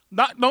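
loud burst of imitated Vietnamese gibberish; mock angry-sounding Vietnamese. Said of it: "sounds like they're very angry".

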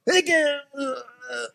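high strained gasp, trailing off; mock a man straining to lift; strained voice fading into a grunt. Buddy straining at machine.

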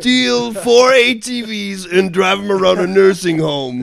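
high, strained mock-dramatic shouting; loud, impassioned parody plea; hammed-up Dom speech. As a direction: yell it like an overwrought action hero.

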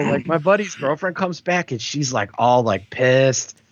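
normal talking voice

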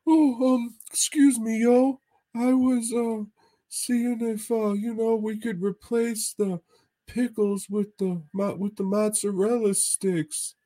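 Mahoney impression. Friend ordering appetizers from the waitress, high goofy imitation. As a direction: mimic a buddy's high, hesitant ordering voice. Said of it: "Mahoney's like".

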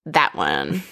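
smitten toreador. Retold or imitated. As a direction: drop way down and purr it like a swaggering bullfighter. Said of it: "And he's like".

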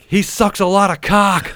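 high, loud, slow mocking yell. Coworker yelling so the dentist's receptionist hears, high and loud. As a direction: yell it across the room to embarrass him. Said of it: "he yells out".

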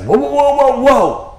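high, loud alarmed whoa. Retold or imitated. Jesus stopping Peter's plan, high loud alarm. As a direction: throw up the brakes, high and loud.

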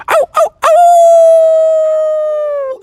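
high, loud, drawn-out wolf howl. Acted. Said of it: "the wolf was going".

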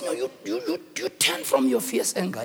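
soft, stammered plea; falter, then urge it under the breath; hesitant Moses. Acted. Moses dares to talk back to angry God, hesitant.

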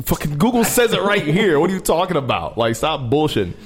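high-pitched retold outburst; exasperated. Bouncer retells confronting man over Google Translate, exasperated. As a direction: argue it indignantly, starting high and easing down.

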